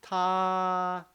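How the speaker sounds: "Ta" held long, high and level; high, even, sustained "Ta"; prolonged first tone. Shown as a demonstration. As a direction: stretch "Ta" at the top of the range. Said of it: "so you can make it very long".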